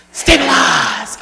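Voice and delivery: sharp gasp, then slow drawn-out stabilize; gasp, then slowly settle yourself; calming mantra. Shown as a demonstration. Speaker demonstrating the meltdown whammy to consultants. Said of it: "everybody try it".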